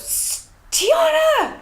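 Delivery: high, drawn-out call of the name Tiana; mock a mother stopping her daughter short; mom calling out. Mom on the Venice street calling her daughter's name. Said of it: "she stops and she just goes".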